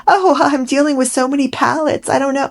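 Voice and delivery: high, airy mock of Megan, laughing; a breezy, evasive impression of her; mock-flustered celebrity voice. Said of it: "She said something like".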